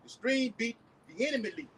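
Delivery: slow, loud preaching read; scripture reading. Acted. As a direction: proclaim the verse slowly. Reads scripture aloud.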